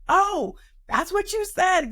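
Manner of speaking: high, drawn-out dawning realization; exaggerated, slow "now I get it"; sudden realization. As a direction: re-enact the penny finally dropping. Acting out sudden understanding.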